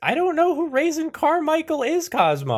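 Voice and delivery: very high, loud yelled retort; exasperated shout. Acted. Phone assistant yells back at Cosmo, exasperated. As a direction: yell back at the caller in exasperation.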